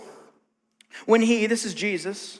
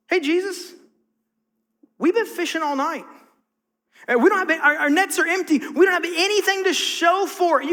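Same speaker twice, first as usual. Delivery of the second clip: higher, fed-up tired fisherman; exasperated Peter; 6 semitones higher than usual. Retold or imitated. Peter pushes back on Jesus, exasperated. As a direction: let the frustration leak through. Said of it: "you can hear the exasperation in his voice".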